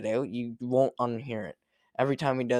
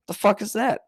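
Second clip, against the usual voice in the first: incredulous; 7 semitones higher, 5 dB louder than usual. Real, not acted. Incredulous at researchers' conclusion.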